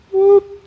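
high, loud whoop for a sudden teleport; a cartoon whoosh as everyone vanishes; whooping teleport noise. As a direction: fling out a high zap of a whoop. Whole team teleports at once.

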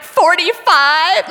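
high, drawn-out questioning whimper; stretched, rising, hammed-up uncertainty; mock tearful guess. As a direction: guess timidly as if starting to cry. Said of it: "I immediately start crying".

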